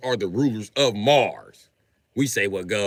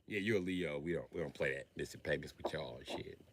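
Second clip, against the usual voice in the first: lower, much softer dismissive reply; cold collector; 9 semitones lower, 18 dB quieter than usual. Acted. Heaven's collector refusing the Leo, low soft dismissal. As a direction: brush him off coolly.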